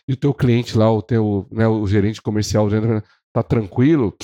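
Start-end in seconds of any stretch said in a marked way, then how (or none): none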